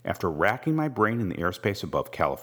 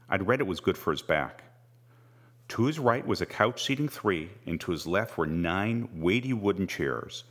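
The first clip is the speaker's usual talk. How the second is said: ordinary delivery, nothing marked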